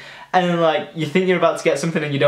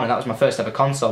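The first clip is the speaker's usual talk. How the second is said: ordinary delivery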